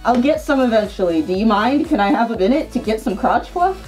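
usual speaking voice

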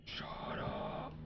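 hushed whisper to the game; sharp real whisper. Real, not acted. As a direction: whisper it so the ghost won't hear. Hushes the ghostly voice.

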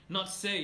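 "say" with a plain "s", as the mistake; plain S demo. Shown as a demonstration. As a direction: quote the wrong syllable "say", no H. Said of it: "You said say, not".

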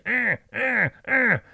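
high mock squirrel chatter; squirrel noise. Squirrel shouting at his cat, high mock chatter. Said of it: "Literally this noise".

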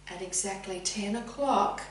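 usual speaking voice

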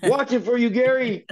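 high-pitched holler to a friend; playful shout-out. Pretend. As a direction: call out high, teasing him. Playfully calling out to Gary Machuda.

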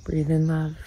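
guided meditation. Acted. Closing the video by guiding viewers to feel loved, soft meditation. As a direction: lull them into calm.

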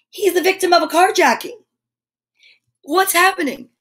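high-pitched scandalised outburst; mock alarm. Reader reacting to the car being stolen, mock alarm. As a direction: exclaim it in pretend panic.

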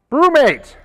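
high, loud shout of a name; sudden yell. Real, not acted. Host yelling a name printed in all caps. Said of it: "I had to yell that one".

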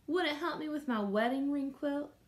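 ordinary delivery

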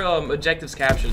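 ordinary talk

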